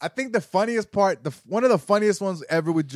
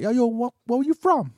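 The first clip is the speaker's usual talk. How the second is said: imitated New Yorker; 8 semitones higher than usual. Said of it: "Even New Yorkers are like".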